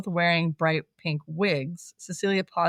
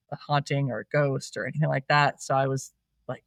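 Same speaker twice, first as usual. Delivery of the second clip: usual speaking voice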